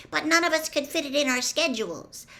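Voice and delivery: high-pitched, earnest female voice; anxious girlish justification; pleading girl. A narrator's reading. Girl explains herself anxiously.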